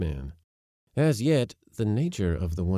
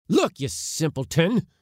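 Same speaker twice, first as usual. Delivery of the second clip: loud, higher-pitched scolding; exasperated rebuke; 9 semitones higher, 5 dB louder than usual. A narrator's reading. Uncle silencing his complaining nephew, exasperated.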